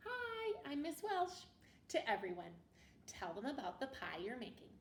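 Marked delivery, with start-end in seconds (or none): 0.1-1.5 cheery greeting. Pretend. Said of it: "Introduce yourself".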